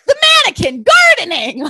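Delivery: high, loud shouted note; all caps excitement. Retold or imitated. Cohost reading her own notes aloud, shouting. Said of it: "in caps".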